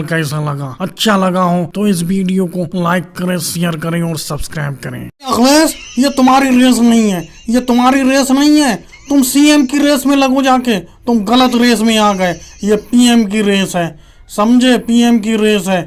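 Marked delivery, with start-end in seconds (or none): 5.0-15.8 raised-pitch mock politician voice; hammed-up elder statesman correcting someone; scolding senior politician. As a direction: admonish a junior like a leader impressionist.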